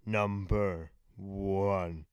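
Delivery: slow, drawn-out announcement; announcer voice. Shown as a demonstration. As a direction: announce it like a fighting game host. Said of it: "Do, like, a really cool announcer voice".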